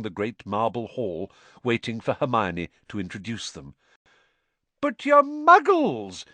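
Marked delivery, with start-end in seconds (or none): 4.8-6.2 high, loud, drawn-out delight. A narrator's reading. Mister Weasley meets Hermione's parents, delighted. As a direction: marvel at it, stretching the words. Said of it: "said Mister Weasley delightedly".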